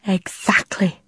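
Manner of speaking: a hushed, breathy exactly; whispered. A narrator's reading. Hushed reply to the guard.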